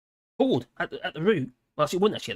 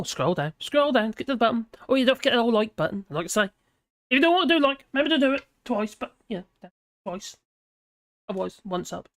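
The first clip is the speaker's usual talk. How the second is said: normal talking voice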